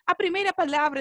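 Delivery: usual speaking voice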